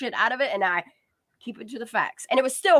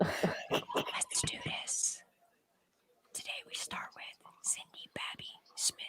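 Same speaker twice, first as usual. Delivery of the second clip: breathy ASMR whisper; 14 dB quieter, slower than usual. Pretend. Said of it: "Can you do your A S M R voice?".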